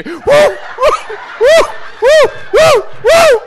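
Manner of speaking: high, loud panting woos; hammed-up breathless woos of a scared rider; mock huffing and puffing. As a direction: huff and puff like someone in labor. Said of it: "huffing and puffing like he was having a baby".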